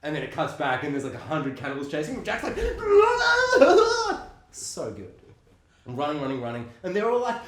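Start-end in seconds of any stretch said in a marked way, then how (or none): 2.6-4.3 very high, loud mock scream; hammed-up shriek of someone being chased; panicked fleeing yell. Imitating Jack fleeing cannibals.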